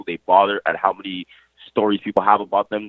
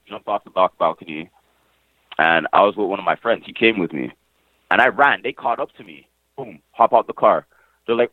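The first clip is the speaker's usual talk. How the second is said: ordinary delivery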